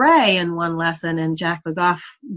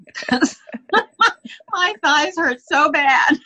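laughing; 8 semitones higher than usual. Real, not acted. Laughing about painful sore legs.

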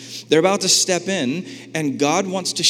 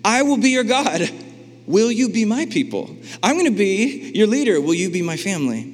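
higher, earnest voice of God making a vow; God proposing to his people, higher and earnest; God's earnest vow; 4 semitones higher than usual. Retold or imitated. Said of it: "He says".

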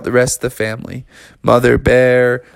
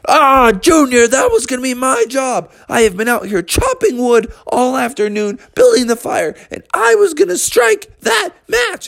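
whiny bear; 12 semitones higher than usual. Acted. Bubby complains about his job.

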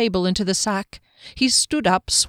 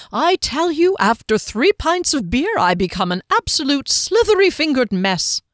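higher, jolly self-mocking; tipsy halfling; 7 semitones higher, 3 dB louder than usual. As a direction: laugh at your own clumsiness. Tipsy Hector self-deprecating.

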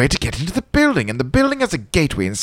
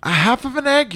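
high curious question; 9 semitones higher than usual. Acted.

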